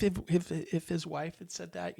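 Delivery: ordinary talk, nothing marked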